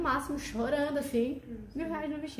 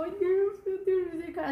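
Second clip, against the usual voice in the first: high-pitched mock-tearful cry to God; retold tearful plea; 6 semitones higher than usual. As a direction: re-enact a weepy plea, pitched well up. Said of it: "quase cho-, eu chorava".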